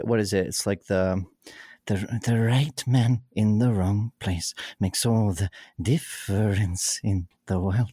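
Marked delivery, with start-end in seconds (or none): 1.8-7.9 slow, unsettling lightly voiced G-Man; drawn-out creepy government man. Shown as a demonstration. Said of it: "I don't know if I could do that voi".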